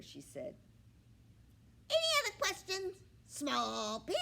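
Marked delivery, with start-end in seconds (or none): 1.9-4.2 high, loud, fast taunting squawk; mocking hen squawk. A narrator's reading. The hen taunts the pig from her perch.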